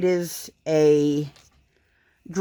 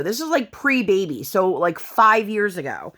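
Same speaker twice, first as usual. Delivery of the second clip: ordinary talk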